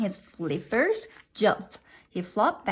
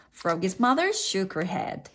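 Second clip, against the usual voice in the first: usual speaking voice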